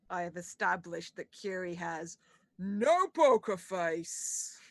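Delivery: stretched, loud "no poker face"; emphatic. Real, not acted. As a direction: lean hard and slow on "no poker face". Player exclaims about NPC's face.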